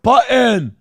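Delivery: "button" as "butt-EN"; loud, stressed "butt-en"; extra vowel. Retold or imitated. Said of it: "like, E in the word".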